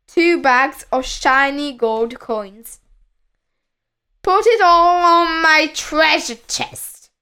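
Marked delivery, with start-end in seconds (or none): 4.2-7.1 raised, commanding pirate voice; higher-pitched pirate command; captain's order. A narrator's reading. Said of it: "ordered the captain".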